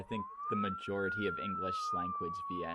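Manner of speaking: very high, fast squeak; squeaky voice. Acted. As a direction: pipe it up high and quick. Squeaky sped voice mid-statement.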